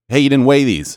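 retelling how the grocery store lady snapped at him, loud; imitating a sharp cashier. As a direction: bark it like the annoyed grocery clerk. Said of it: "Of course, this was in Polish".

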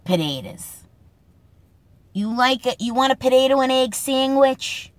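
an imitation of a North End dad; imitating her father from the North End in a Boston accent. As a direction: mimic a North End father offering food. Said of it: "he's from the north end of Boston, and he'd say".

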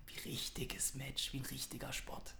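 mock-secretive whisper. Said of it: "Warum flüsterst du denn so?".

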